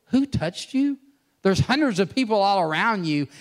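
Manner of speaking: high, loud incredulous disciples; high loud disbelieving retort; mocking disciples. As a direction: toss it off like an exasperated crowd.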